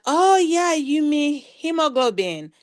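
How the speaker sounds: bright, high "oh" then a slow, clear hemoglobin; imitating someone catching on at last; sudden realization. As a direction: play the listener who finally gets it. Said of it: "someone will be like".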